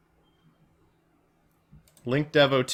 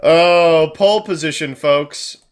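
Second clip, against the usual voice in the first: gloating; 4 semitones higher, 8 dB louder, slower than usual. Real, not acted. Gloating after a win.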